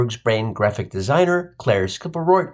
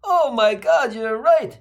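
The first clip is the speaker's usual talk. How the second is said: bemoaning mother; 8 semitones higher, slower than usual. Retold or imitated. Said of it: "she bemoaned".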